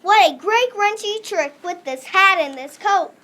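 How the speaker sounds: normal talking voice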